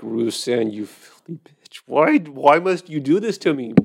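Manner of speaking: mild mock heartbreak; mock despair at the wine. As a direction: ham up betrayal by a bottle. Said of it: "I'm like".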